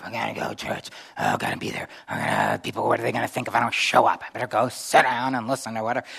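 a hint of a dragging, reluctant believer; grumbling reluctant churchgoer. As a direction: sulk your way to church, lightly voiced. Acts out reluctant churchgoer's thoughts.

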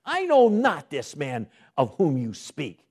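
loud, slow, forceful denial; Peter denying. Acted. Preacher voicing Peter's loud angry denial. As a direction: make Peter's denial ring out.

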